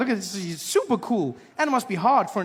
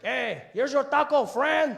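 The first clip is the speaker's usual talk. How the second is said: put-on higher, slow Mexican-accented vendor; taco vendor hands over the taco; 6 semitones higher, slower than usual. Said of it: "he's like".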